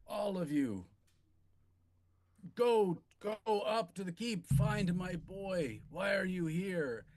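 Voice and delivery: lightly voiced weakening lord; fading lord's order. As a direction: push them off to the keep.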